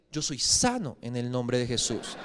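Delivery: low, slow, stubborn declaration; stern father. Retold or imitated. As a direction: insist gravely, soft and deliberate. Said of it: "me mira como bravo y me dice".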